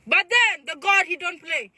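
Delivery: high, loud proclamation; dramatic turn. Real, not acted.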